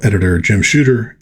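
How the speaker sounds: ordinary delivery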